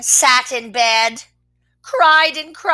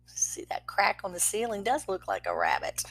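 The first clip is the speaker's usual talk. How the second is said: whispered aside; 4 semitones lower, 12 dB quieter, faster than usual. Real, not acted. Parent pausing the story to whisper to the child. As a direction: murmur the comment under your breath.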